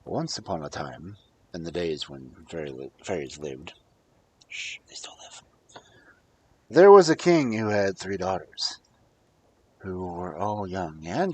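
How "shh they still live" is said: soft secret whisper; shushing aside to the listeners; conspiratorial whisper. Acted. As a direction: let them in on a secret.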